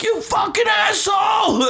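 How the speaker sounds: very high, stretched-out scream; yelling heckle. Retold or imitated. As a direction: scream an insult at the player from the stands. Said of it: "Keep yelling at him".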